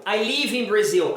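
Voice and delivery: "live" said as leev; slow example with "live" as "leev"; wrong long vowel. Shown as a demonstration. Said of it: "você não vai falar".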